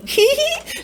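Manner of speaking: tiny high cackle of glee; sly high giggle. A narrator's reading. Old woman giggles slyly as she tricks the fairies, high. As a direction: giggle as she slips away.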